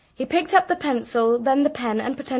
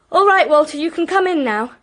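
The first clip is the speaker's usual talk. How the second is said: calling out; 4 semitones higher, 8 dB louder than usual. Retold or imitated. Uncle calling offstage to Walter.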